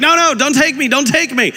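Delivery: high, frantic kid imitation; mock child's screaming. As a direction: play a panicked child protesting, pitch jumping up. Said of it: "they're screaming, and they're like".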